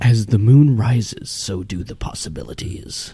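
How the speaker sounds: mock dramatic slogan. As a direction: read it like a grand, mysterious beer ad, very slowly. Reads bottle slogan as mock ad.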